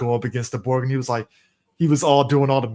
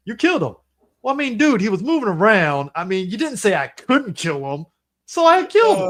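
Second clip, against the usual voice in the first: high-pitched defensive movie character; Don Cheadle; 6 semitones higher, 4 dB louder than usual. Retold or imitated. Retelling the best line ever, high indignant excuse. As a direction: protest it, climbing to a loud high finish. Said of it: "Don Cheadle was like".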